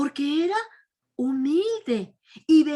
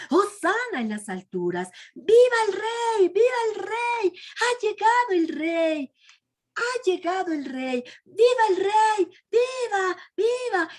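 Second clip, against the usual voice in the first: higher, shouted acclamation; cheering crowd; 5 semitones higher than usual. Retold or imitated. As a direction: shout your joy for the king. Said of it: "Eso aclamaban todas esas gentes". (la multitud aclama al rey a gritos)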